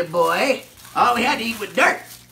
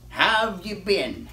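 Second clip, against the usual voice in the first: ordinary talk, nothing marked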